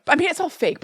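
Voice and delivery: ordinary talk, nothing marked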